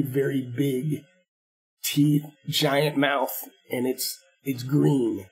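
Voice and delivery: very slow, drawn-out words; a slow, hushed warning about the monster; slow ominous description. Acted. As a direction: stretch it out to spook them. Chief describing monster slowly.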